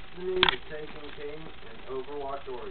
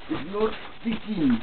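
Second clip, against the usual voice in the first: put-on Russian accent; 5 semitones higher, 5 dB louder than usual. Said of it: "why am I talking in a Russian accent".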